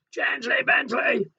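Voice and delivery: high calling shout; scolding call. Acted. Reader voices the refrain as Bentley thunders downstairs, high shout. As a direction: call it out high over the noise.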